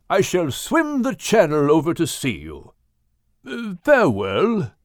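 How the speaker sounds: genteel English whale voice; proper English whale. Acted. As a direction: play Craddock reporting landfall. Craddock whale voice.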